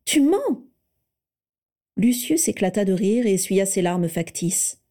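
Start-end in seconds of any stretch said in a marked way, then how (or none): 0.1-0.6 incredulous accusation. A narrator's reading. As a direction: catch him lying, cry it out. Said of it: "s'exclama-t-elle incrédule". (« Tu mens ! » lancé, incrédule et bien plus aigu)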